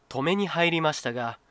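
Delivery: plain reading voice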